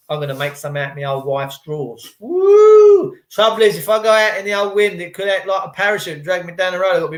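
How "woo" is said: high, loud, held "woo"; playful whoop. Real, not acted. Joking about masks from wife's drawers, playful whoop. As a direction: let out one long high whoop.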